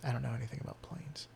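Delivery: whispered aside, fast; whispering. Real, not acted. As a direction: whisper it quickly under your breath.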